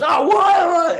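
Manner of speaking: very high, loud heckler holler; mock outraged yell. Heckler told off by the comedian, mock outraged yell. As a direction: shriek it in exaggerated disbelief.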